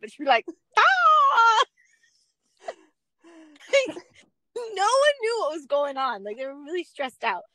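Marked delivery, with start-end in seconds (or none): 0.8-1.6 high, loud mock yell to startle; jump-scare yell at a victim; sudden scare shout. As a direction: mom scaring the kidnapped girls, loud mock yell. Mom scaring kidnapped girls.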